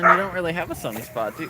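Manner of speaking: ordinary talk, nothing marked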